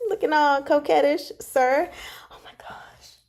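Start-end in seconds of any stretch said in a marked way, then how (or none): 2.3-3.2 breathless whisper of amazement; awed whisper. Real, not acted. Fan seeing Wan Cheng's art, awed whisper. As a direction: whisper it, stunned by the picture.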